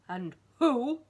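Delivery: loud booming giant voice; thundering giant. Acted. Giant to tiny Mister Greedy, thundering. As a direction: roar it down from a great height. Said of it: "thundered the giant".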